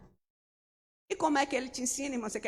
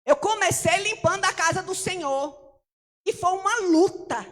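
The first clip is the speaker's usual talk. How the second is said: higher and louder, declared with conviction; emphatic; 7 semitones higher, 8 dB louder than usual. Shown as a demonstration. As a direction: proclaim it like a testimony. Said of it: "mas eu vou falar".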